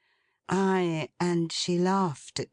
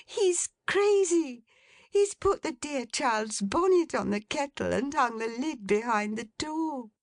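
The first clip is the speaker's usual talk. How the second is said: Meg crying out; 9 semitones higher than usual. Acted. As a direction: scold father half laughing. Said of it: "cried Meg".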